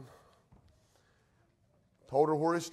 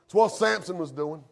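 ordinary talk

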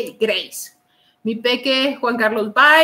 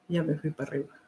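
"Ya me fui para arriba" said much lower and much softer, in an odd put-on voice; low murmured voice; 8 semitones lower, 13 dB quieter, much faster than usual. Shown as a demonstration.

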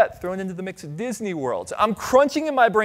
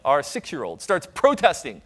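normal talking voice

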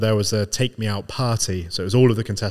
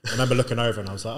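usual speaking voice

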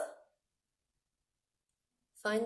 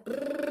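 higher lip trill; voiced lip roll; 5 semitones higher, 4 dB louder than usual. Shown as a demonstration. Teacher shows the trill while shaking the fly. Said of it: "we're gonna shake the fly".

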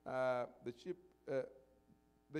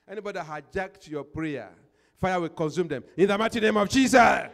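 fiery shouted prayer, rising loud; 2 semitones higher, 15 dB louder than usual. Real, not acted. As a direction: shout the prayer with full force. Pastor's fiery prayer against enemies.

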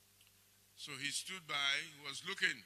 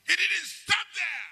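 preaching at full force; 13 semitones higher, 12 dB louder than usual. Acted. Shouted declarations to church.

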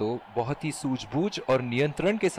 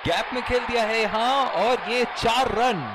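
excited cricket commentary; 8 semitones higher than usual. Acted. Boundary called live.

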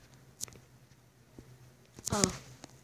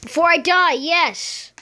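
higher, louder burst of relief; excited relief; 5 semitones higher, 4 dB louder than usual. Real, not acted. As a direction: cheer it just in time. Grabs health just in time.

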